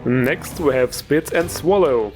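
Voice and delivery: ordinary delivery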